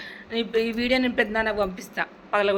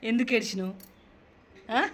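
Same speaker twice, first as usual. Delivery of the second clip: ordinary delivery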